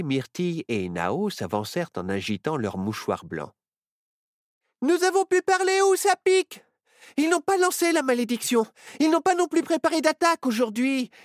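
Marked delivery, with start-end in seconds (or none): 4.8-11.1 put-on much higher, louder little girl voice; a bright-eyed girl reporting eagerly, pitched high; Myrtille's voice. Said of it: "dit Myrtille". (voix aiguë de petite fille, qui rapporte avec entrain)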